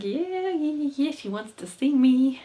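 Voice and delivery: giddy, high-pitched boasting; gassed. Acted. Jezal gets a note from a woman, high giddy excitement. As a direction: squeal it high, thrilled with yourself. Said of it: "And he's gassed".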